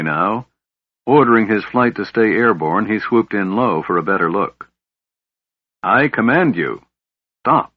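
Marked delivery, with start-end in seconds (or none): 5.8-7.7 strong clear command. A narrator's reading. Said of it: "The voice was strong and clear".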